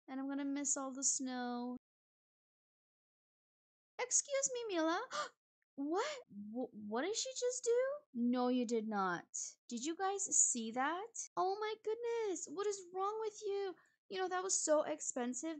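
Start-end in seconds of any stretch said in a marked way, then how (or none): 4.0-6.0 much higher, indignant excuse me; shocked. Real, not acted. Player catching guest Mila misbehaving, shocked outrage. 6.5-15.6 much higher, fast disbelieving outrage; outraged. Real, not acted. Player ranting at Mila for wrecking something expensive, outraged. Guest destroyed expensive item.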